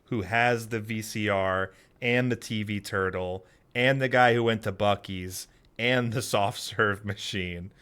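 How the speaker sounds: normal talking voice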